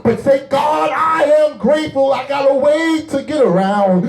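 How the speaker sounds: high, driving pulpit delivery; full-force preaching. Acted. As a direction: preach it hard with stretched key words.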